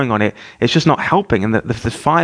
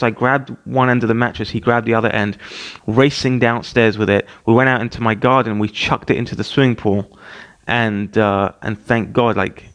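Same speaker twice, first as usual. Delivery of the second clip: plain reading voice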